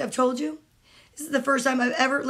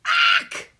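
high, sharp startled yelp; 3 dB louder than usual. A narrator's reading. Coco seeing Max flood the floor, sudden high yelp. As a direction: shriek in alarm. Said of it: "yelled Coco".

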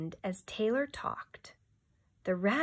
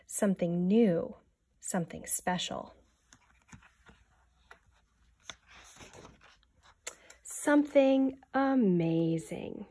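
put-on reading to children. A narrator's reading. Teacher reading a picture book aloud to the class, hushed. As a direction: draw the children into the story.

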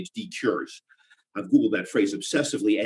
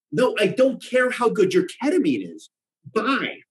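exasperated; 9 semitones higher than usual. Acted. Hanging up annoyed.

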